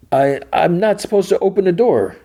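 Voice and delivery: very high, stammered refusal; imitated distressed protest; calling back. Solo's refusal yelled through door.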